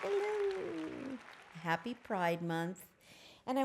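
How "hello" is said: very high, bright greeting; high sing-song hello. Real, not acted.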